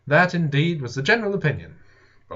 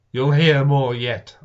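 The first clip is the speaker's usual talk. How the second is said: grim warning; 7 dB louder, slower than usual. A narrator's reading. Stung narrator warns.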